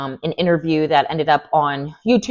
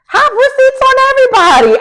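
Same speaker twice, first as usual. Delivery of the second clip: excited; 8 dB louder than usual. Real, not acted. Urging receipts, excited high.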